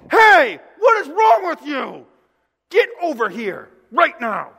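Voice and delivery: loud, high, slow scolding yell; Jesus summoning his disciples with an angry shout. Retold or imitated. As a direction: bellow at them to come over. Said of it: "that's how I would act".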